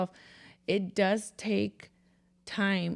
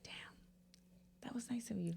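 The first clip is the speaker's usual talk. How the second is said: hushed whisper to herself; inner whisper; 2 semitones higher, 16 dB quieter than usual. Retold or imitated. Talking to herself in her head, hushed whisper. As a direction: whisper it like a private thought. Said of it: "in my head now".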